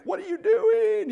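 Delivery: imitating a baby left in a crib; crying toddler. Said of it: "and I was crying".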